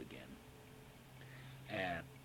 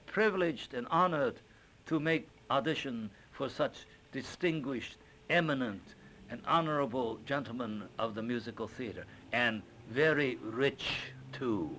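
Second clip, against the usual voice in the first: put-on a touch of Chinese accent, formal and higher; bow to the producers in a light Chinese accent; flowery humble audition speech in a light Chinese accent; 4 semitones higher, 5 dB louder than usual. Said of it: "with a little Chinese accent".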